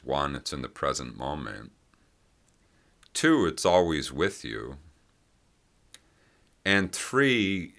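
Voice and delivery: ordinary delivery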